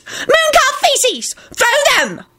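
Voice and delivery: very high, drawn-out shrill order; shrieked command. A narrator's reading. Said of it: "Dorcas shrieked".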